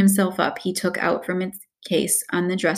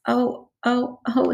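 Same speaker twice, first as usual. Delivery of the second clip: higher, acted sobbing moans; 6 semitones higher, slower than usual. A narrator's reading. Mister Tumnus crying to Lucy, acted sobs. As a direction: wail it as a tearful character. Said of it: "sobbed Mister Tumnus".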